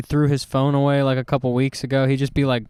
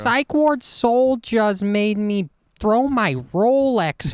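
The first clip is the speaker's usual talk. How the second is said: mock Josh; 7 semitones higher, much slower than usual. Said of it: "he's gonna be like".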